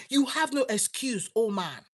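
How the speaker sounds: ordinary talk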